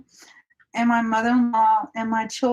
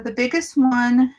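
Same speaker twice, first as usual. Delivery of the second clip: normal talking voice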